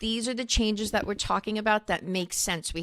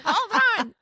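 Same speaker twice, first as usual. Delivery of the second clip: high mock phone voice; 12 semitones higher, 6 dB louder than usual. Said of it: "She's like".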